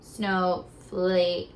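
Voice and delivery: Snowflake with the long A sound stretched out. Shown as a demonstration. Teacher sounding out snowflake for the children. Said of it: "that's a long A".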